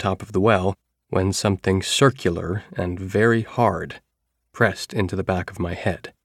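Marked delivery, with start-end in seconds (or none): none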